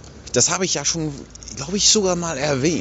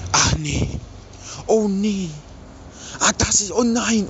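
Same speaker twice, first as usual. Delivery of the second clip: mock dismay in a higher voice; imitierte bestürzte Ehefrau; 6 semitones higher, slower than usual. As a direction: replay the wife's mounting dismay, pitch climbing. Spielt bestürzte Reaktion der Frau. (nachgespielte Bestürzung, hohe Stimme)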